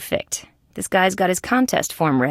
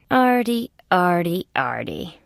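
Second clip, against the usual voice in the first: chiding disappointment. Acted. Detective chiding Artie by name.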